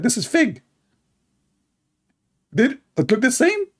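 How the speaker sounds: high, mocking preacher voice, bossy asides; imitating Lady Dawa. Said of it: "Lady Dawa, he speak like this".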